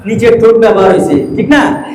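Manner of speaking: usual speaking voice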